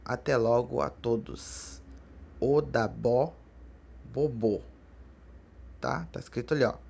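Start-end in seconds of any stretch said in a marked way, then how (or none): 2.4-4.6 Odabó, pause, then bobô, word by word; demonstrated pronunciation. Shown as a demonstration. Teacher repeats the farewell for students, carefully split word by word. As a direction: say Odabó and bobô separately, stressing each last syllable. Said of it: "mas pronuncia-se bobô".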